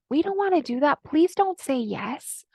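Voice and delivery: high little voice, slowing at the end; little inner voice. Acted. Inner voice pleading with its owner, little voice. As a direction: plead lightly as a small inner voice. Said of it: "that little voice comes up".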